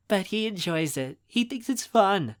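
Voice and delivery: put-on high, cheery young woman's voice; light, upbeat and pitched high; perky girl. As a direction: chirp it in defense of him. Cheery girl defends the busy man.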